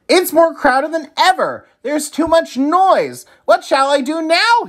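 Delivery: high, pleading and desperate; begging Oscar. A narrator's reading. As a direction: beg for help. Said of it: "he begged".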